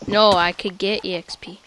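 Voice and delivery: normal talking voice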